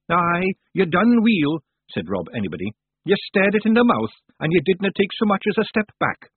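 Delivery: high, emphatic Scots praise; Rob Anybody, hearty little Scot. Acted. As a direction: voice a feisty Scottish pal giving credit.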